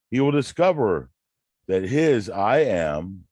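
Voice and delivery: declaiming a quote with conviction; reading aloud. Acted. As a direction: read it like a preacher's text. Reads Neville passage aloud.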